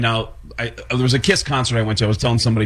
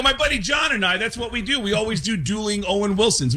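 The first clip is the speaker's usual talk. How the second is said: fake enthusiasm; 9 semitones higher than usual. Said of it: "I'll lie and go".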